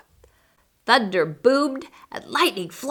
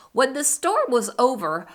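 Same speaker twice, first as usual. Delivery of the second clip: ordinary delivery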